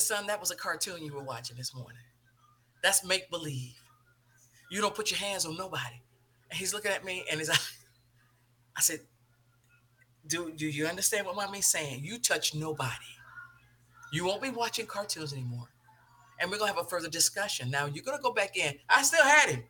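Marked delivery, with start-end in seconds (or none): 0.0-6.0 low, hushed, controlled scolding; quiet stern mother. Retold or imitated. Mother telling her son off after choking incident, quietly stern. 10.3-18.8 low, measured motherly reprimand; quiet stern warning. Retold or imitated. Mother warning her little son to touch nobody, quiet and stern.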